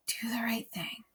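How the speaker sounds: whispered, fading echo. A narrator's reading. Grandfather's advice echoing in Bryce's mind, whispered. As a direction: let it echo back in a whisper. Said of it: "repeated echo of grandfather".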